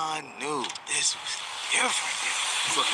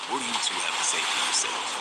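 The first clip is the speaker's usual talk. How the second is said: stern dad; 8 semitones lower, faster than usual. Retold or imitated. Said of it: "my pops looks dead at both of us and goes".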